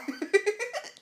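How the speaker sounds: very high giddy giggle. Real, not acted. Laughing at coworkers after her happy answer, high giggle. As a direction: let a delighted giggle escape.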